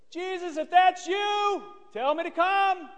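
very high, strained call; high-pitched shouting. Acted. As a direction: holler it far out in a comic high voice. Peter shouts across the water.

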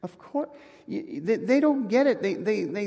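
ordinary talk, nothing marked

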